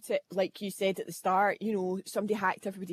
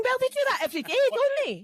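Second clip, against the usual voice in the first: much higher mock voice of a passer-by; unbothered bystander; 4 dB louder, faster than usual. Onlookers ignoring marching robot penguins, much higher put-on voice. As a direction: shrug it off in a high, put-on voice. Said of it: "they're going".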